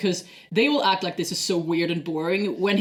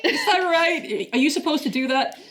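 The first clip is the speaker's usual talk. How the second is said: mock disbelief; 7 semitones higher than usual. Imitating puzzled crewmates questioning Data, high mock disbelief. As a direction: play up the baffled concern. Co-host echoes crewmates' baffled reaction.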